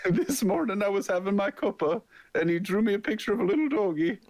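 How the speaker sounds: put-on high Irish old lady accent; Irish mom accent. Friend playing an Irish mom praising drawings, high Irish lilt. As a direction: coo it in an Irish granny accent. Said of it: "North Irish".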